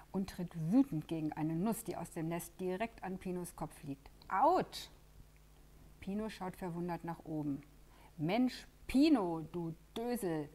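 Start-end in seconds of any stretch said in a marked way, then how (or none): 4.3-4.9 ouch. Acted. Nut hits Pino's head. 8.2-10.5 a loud call down from the nest, teasing. Acted. Lela calls down to Pino from the nest, loud and teasing. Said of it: "ruft Lela herab".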